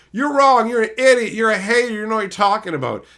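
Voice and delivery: loud, high jeering yell of angry fans; mock angry commenters. Streamer imitating fanboys yelling he is wrong, loud mocking jeer. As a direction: shout down the streamer like a furious fanboy.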